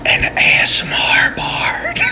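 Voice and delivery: unvoiced whisper close to the mic; ASMR whisper. Bard casting spells into a microphone, ASMR whisper. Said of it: "through whispering into a microphone".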